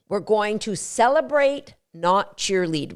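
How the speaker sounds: normal talking voice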